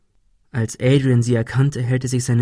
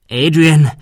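loud, raised cry; shouted name; 4 semitones higher, 8 dB louder than usual. A narrator's reading. Thomas crying out to the armed Adrian, shouting. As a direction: call out sharply to stop him. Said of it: "schrie Thomas".

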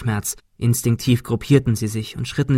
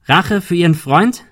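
raised, pressing question rising at the end; calling out; 6 semitones higher, 5 dB louder than usual. A narrator's reading. Ben challenges the gunman, calling out. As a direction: throw the question across loudly. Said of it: "rief Ben".

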